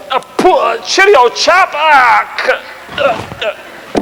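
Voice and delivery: high, choked grunts fading to breath; a front-row soldier dying on the spot; mock dying gasps. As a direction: act out being shot and collapsing. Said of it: "you just go".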